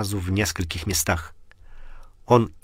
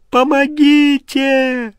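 high, louder, slow dying-off cry; desperate final scream; 8 dB louder, much slower than usual. Acted. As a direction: push the last plea out in despair. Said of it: "Он проснулся в холодном поту".

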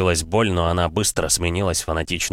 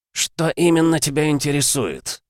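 higher, mocking question; caustic sneer; 9 semitones higher than usual. A narrator's reading. Undead father taunts his angry son, caustic sneer. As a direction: toy with him, voice lifted in mockery. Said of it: "с язвительной усмешкой переспросил тот".

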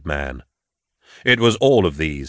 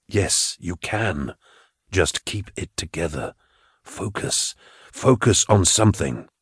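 Gideon's psychic voice; 4 dB quieter than usual. Acted. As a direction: speak gently into her mind, calming.

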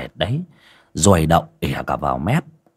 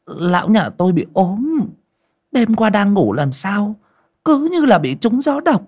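high, eager chattering woman's voice; fawning wife; 11 semitones higher than usual. A narrator's reading. Wife welcoming the exorcist, high fawning chatter. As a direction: greet the exorcist effusively, pitch climbing. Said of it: "bà đon đả".

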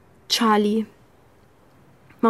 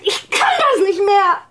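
breaking down; 7 semitones higher than usual. Acted.

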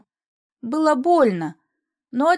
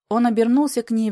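usual speaking voice

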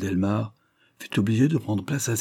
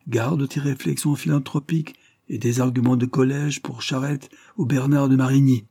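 higher, louder scornful warning; menacing Delmare; 5 semitones higher, 4 dB louder than usual. A narrator's reading. Delmare warns the citizen general before leaving, menacing. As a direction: lecture the moderates with raised voice.

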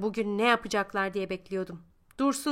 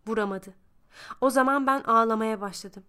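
ordinary delivery

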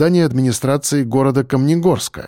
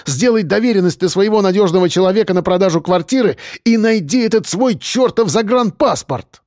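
raised-pitch exasperated outburst; heated tirade without extra volume; losing patience, shouting; 6 semitones higher than usual. A narrator's reading. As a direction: lay down the law to your mother, pitch up. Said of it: "не выдержав, Марк крикнул".

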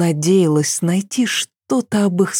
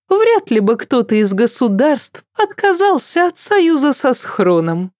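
much higher, pointed princess; Cecile's voice; 7 semitones higher than usual. A narrator's reading. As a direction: press the question, pitched high. Cecile keeps questioning. (голос Сесиль, высоко и настойчиво)

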